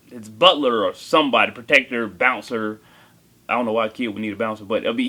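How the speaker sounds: ordinary delivery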